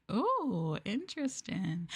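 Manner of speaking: ordinary talk, nothing marked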